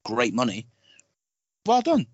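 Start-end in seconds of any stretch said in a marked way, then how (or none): 1.6-2.1 high, punchy praise that lands as sarcasm; sarcastic-sounding. Real, not acted. Podcaster praising a film studio, unintentionally sarcastic-sounding. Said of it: "that sounds sarcastic".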